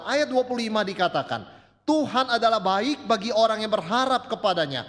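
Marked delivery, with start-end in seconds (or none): 1.9-4.8 scripture reading. Acted. Reading the Bible verse aloud.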